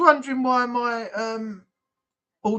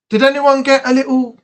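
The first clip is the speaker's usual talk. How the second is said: normal talking voice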